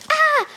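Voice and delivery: very high, short shriek; high-pitched "Ah!" of fright; acted frightened scream. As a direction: scream at the sudden shadow. Startled by the shadow, told to calm down.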